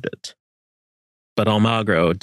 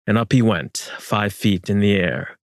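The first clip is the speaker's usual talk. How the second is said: usual speaking voice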